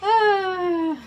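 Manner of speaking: loud open yawn, pitch jumping up; big yawn. Real, not acted. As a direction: let out a real loud yawn. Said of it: "I yawn a lot".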